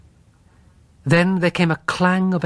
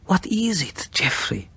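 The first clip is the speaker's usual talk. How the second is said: frightened whisper; 4 semitones higher, 4 dB quieter than usual. Acted. Frightened wife at night.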